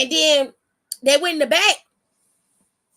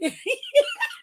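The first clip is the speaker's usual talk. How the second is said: 1 high laugh. Real, not acted. Laughing at her own impression.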